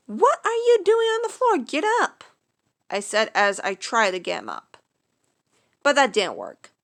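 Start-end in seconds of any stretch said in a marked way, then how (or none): 0.1-2.2 high-pitched, incredulous push; exasperated high urging. Acted. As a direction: pitch up, half laughing at him. Urging patient off floor.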